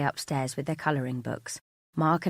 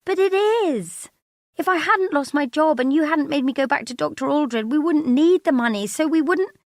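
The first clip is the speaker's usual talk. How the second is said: high, loud, frantic argument; upset outburst; 12 semitones higher, 8 dB louder than usual. Acted. Wife arguing with husband about money, upset. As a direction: build into a heated, pleading rant. Wife argues back in a quarrel.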